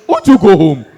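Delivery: exasperated cry at the nosy senior; mock exasperated shout. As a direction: snap back to go home. Retorting to insistent senior.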